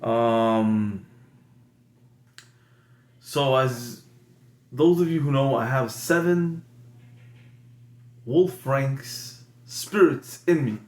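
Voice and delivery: ordinary talk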